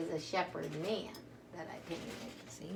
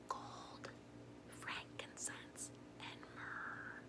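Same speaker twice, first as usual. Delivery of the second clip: soft, much quieter secret whisper; 15 dB quieter, much slower than usual. Real, not acted. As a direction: whisper each gift like a secret. Whispering the gifts to children.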